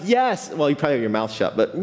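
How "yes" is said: yes popped up much higher; eager congregation yes. Retold or imitated. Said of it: "And you're like".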